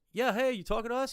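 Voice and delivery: high-pitched holler back; calling back. Retold or imitated. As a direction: imitate a friend shouting back across the water. Friend calling back to neighbour.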